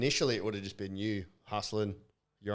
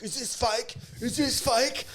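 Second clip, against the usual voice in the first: much higher, jeering "is this fake?"; mocking taunt; 14 semitones higher, slower than usual. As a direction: taunt him like a kid showing off a hold. Mates taunting him in heel lock.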